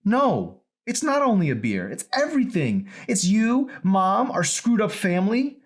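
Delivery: loud, high outburst; daughter shouting. Acted. Daughter yelling that it's everything, loud and high. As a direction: let it burst out at him.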